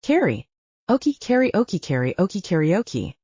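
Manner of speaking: "Carrie, Oki" rolling into "karaoke"; name run together. Acted. Sister saying her name fast to prove the joke, running it together.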